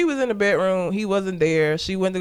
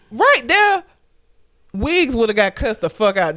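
ordinary talk

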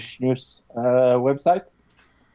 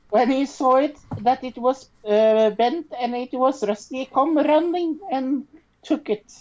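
put-on Swedish accent; 10 semitones higher than usual. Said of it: "I will do this in a Swedish accent".

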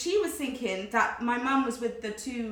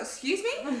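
high, rising, affronted question; incredulous; 11 semitones higher, slower than usual. Retold or imitated. Wrongly accused shopper to security guard, incredulous. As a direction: shoot up in disbelief. Retelling being accused of stealing.

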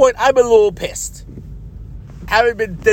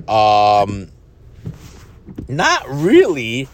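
hesitant reply; 8 semitones lower, much slower than usual. Retold or imitated. His reply to soldier.

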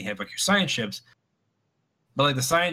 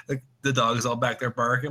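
ordinary talk

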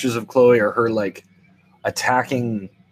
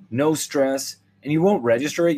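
ordinary delivery

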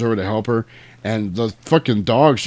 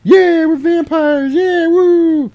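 high, loud drawn-out mock whoops; wild falsetto cheering, hammed up; whooping revelry; 10 dB louder, much slower than usual. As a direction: holler like a gang of revellers in flight. Imitates vampire boys flying home.

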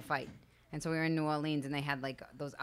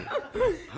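high laugh. Real, not acted. Laughs at own punchline.

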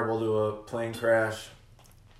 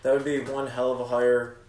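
ordinary talk, nothing marked

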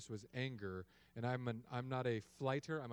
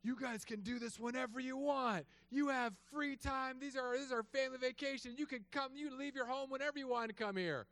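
Amy's furious scold; 12 semitones higher than usual. Retold or imitated. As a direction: scold them as the terrifying wife. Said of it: "Amy goes".

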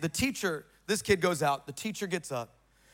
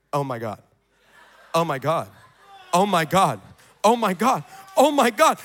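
dawning amazement; 4 semitones lower, 3 dB louder than usual. Retold or imitated. Voicing cheerleader realizing she is healed.

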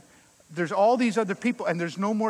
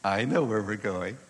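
lower, knowing wife's voice; imitating Helen; 9 semitones lower than usual. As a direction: mimic the wife, low and sure of herself. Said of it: "Helen went something like this".

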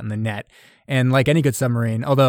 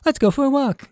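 much higher, put-on female voice; woman's voice; 5 dB louder than usual. Anonymous woman invites him for a walk, mock high female voice. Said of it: "some anonymous woman is like".

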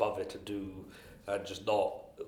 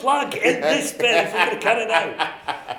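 laughing while talking, louder; 13 semitones higher, 13 dB louder than usual. Real, not acted. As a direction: talk through real laughter. Laughing at the plug joke.

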